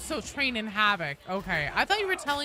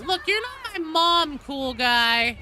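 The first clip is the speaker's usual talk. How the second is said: higher and louder, playfully defiant; sassy pushback; 6 semitones higher, 5 dB louder, slower than usual. Real, not acted. Teasing a chat friend who acts like her mom, sassy and loud. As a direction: brush off the nagging friend with mock attitude.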